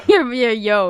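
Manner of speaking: loud, talking through laughter; host cracking up at a co-host's overstatement, laughing. Real, not acted.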